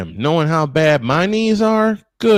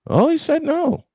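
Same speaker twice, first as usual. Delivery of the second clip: much higher, surprised realization; sudden surprise. Real, not acted. Broadcaster noticing player refuse to come out, surprised. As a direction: catch it mid-sentence.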